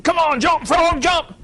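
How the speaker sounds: high, loud mock yell to the frog; yelling coaxing. Scientists yelling at a legless frog, high hammed-up shout. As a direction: scream at the frog to jump, pleading. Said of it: "They yelled and screamed and coaxed".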